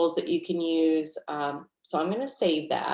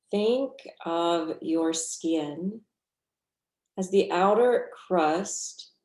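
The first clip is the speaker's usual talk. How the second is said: guided meditation. Real, not acted. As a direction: guide them gently through the breath, unhurried.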